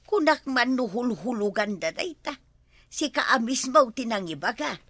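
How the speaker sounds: ordinary talk, nothing marked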